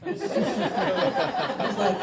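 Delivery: ordinary delivery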